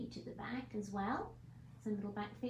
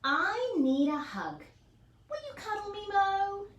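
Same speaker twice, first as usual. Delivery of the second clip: pleading hedgehog; 10 semitones higher, 8 dB louder than usual. Acted. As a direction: ask for a hug, longing and hopeful. Hedgehog asks a third time.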